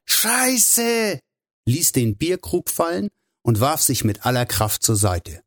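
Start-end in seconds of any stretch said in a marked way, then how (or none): 0.1-1.2 high, alarmed cry without full volume; shouted curse. A narrator's reading. Hugh spots the truck behind his friend, crying out. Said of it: "schrie er laut".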